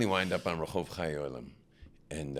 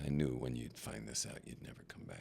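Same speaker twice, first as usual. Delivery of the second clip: fading to an unvoiced hush; hushed reply; 4 semitones lower, 8 dB quieter than usual. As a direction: let it die away into a whisper. Brother's retold answer.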